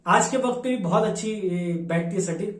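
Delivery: ordinary talk, nothing marked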